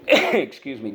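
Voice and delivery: ordinary talk, nothing marked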